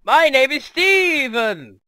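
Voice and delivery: loud, high, drawn-out holler; hammed-up slow shouted self-introduction; mock booming introduction. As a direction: bellow your name out of nowhere. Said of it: "he just goes".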